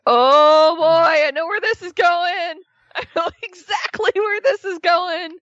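high, loud put-on voice, knowingly amused; imitating her seeing right through the milady act, voice pitched high; mock knowing groan. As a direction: ham up the eye-roll, high and bright with a laugh. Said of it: "And it's like".

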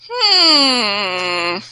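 long, drawn-out hum; mock a pondering hmm; exaggerated drawn-out "hmm"; imitated hmm. Voices a wrestler's reply.

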